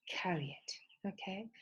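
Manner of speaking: soft, slow whisper. Real, not acted. Teacher urges students to follow their idea, whispering. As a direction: hush it like a secret nudge.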